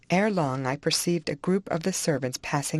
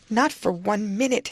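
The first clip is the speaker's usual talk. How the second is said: higher, adamant reply; insistent refusal; 6 semitones higher, 3 dB louder than usual. A narrator's reading. Dying woman won't let her lover go, insistent refusal. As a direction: refuse him point-blank. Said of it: "she replied".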